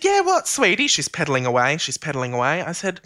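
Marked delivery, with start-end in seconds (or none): 0.0-0.9 high, sing-out mum reply; imitated mum. Busy mum answering her child from the exercise bike, high imitated voice. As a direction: answer as a preoccupied mum, pitched way up.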